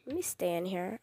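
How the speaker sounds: hushed whisper while hiding. Real, not acted. Hiding from the neighbor, whispering to viewers. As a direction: breathe it so he can't hear.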